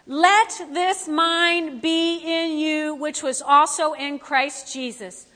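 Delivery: slow, weighty reading of the verse; scripture reading. Acted. Pastor reading Philippians aloud, slow and solemn. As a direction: lay each word down slowly.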